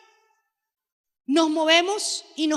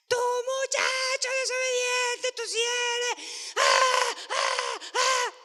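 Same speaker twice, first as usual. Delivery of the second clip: chillido agudo de padre furioso; escándalo de padre; 10 semitones higher, 4 dB quieter, slower than usual. Retold or imitated. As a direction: cacarea como gallina escandalizada. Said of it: "hacemos un escándalo". (padre histérico regaña al hijo desobediente, con chillido agudo)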